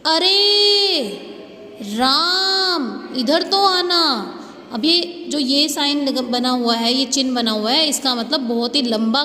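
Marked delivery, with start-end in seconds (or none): 0.1-4.4 "अरे" raised high, "राम" stretched very long; calling from afar. Shown as a demonstration. Teacher demonstrating a drawn-out call to Ram. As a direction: call out to Ram far away, dragging out the name. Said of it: "किसी को दूर से बुलाने के लिए होता है".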